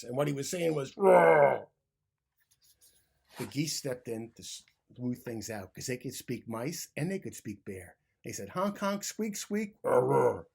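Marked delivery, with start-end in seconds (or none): 0.9-1.7 huge loud bear roar. A narrator's reading. Angry bear Bruce roars at the mice. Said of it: "He roared with a big roar". 8.5-10.5 higher honks and squeaks, then a louder roar; animal noises. A narrator's reading. Geese translate between mice and bear with animal noises.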